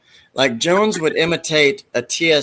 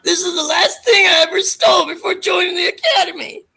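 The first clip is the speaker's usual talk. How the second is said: Bobcat character voice. Retold or imitated. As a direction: wail it like a heartbroken nerd. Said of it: "he's like".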